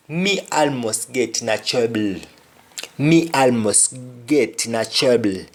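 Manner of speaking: "Me almost get in a trouble" in a Jamaican Patois lilt. Shown as a demonstration. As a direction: say it like a Kingston local. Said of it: "somebody says".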